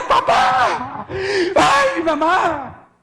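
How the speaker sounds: high, drawn-out screams for parents; gritando por auxilio. Retold or imitated. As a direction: scream like a terrified child. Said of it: "Y empecé a gritar". (gritos agudos pidiendo auxilio)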